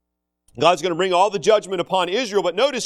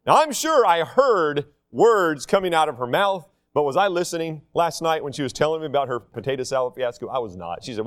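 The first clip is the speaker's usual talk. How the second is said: usual speaking voice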